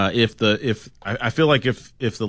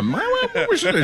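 hesitant guest. Retold or imitated. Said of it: "wasn't even like a".

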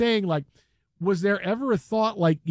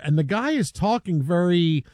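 normal talking voice

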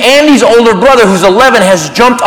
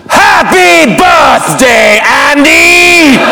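slow, huge, drawn-out birthday roar; all-out Vader greeting; 4 semitones higher, much slower than usual. Acted. Teen Vader bursting out for Andy, all-out slow bellow. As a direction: stretch every word, give it everything. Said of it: "I give it all I got".

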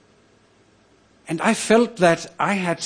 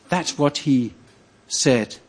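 ordinary talk

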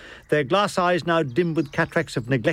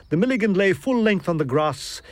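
ordinary delivery

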